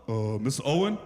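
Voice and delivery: put-on low, hesitant boy on the phone; big-voiced kid nervously calling the dad; deep teen boy voice. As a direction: drop the voice low like a giant teenager. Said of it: "his voice is deeper than mine".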